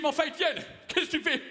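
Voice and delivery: high mock retelling. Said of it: "Je lui dis".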